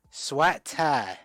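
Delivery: exaggerated Mississippi vowels, "Swat tie" for sweet tea; teasing take on a Mississippi Southern accent; mock Mississippi accent. Shown as a demonstration. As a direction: imitate a Mississippi accent, vowels pulled wide open. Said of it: "Is that how they say it, Mississippi".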